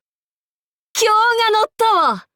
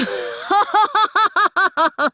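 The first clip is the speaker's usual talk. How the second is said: high, ringing villainous laugh; evil demon-king laugh; demonic cackle; 8 semitones higher than usual. Acted. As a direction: throw back your head and cackle.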